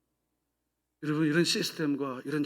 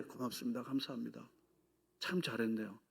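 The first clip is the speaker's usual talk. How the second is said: gentle thanks; 6 semitones lower, 11 dB quieter than usual. Acted. Voicing thanks to a spouse.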